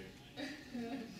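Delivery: soft, high little laugh; real soft chuckle. Real, not acted. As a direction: let out a small, quiet laugh. Chuckles about getting signature.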